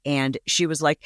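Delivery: ordinary delivery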